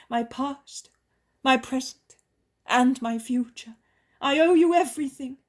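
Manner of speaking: woman pours herself out in gratitude; joyful healed woman. A narrator's reading. Healed woman answers Jesus joyfully.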